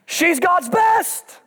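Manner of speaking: high, drawn-out proclamation; full-force preaching. Acted. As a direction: stretch the refrain out to the whole congregation.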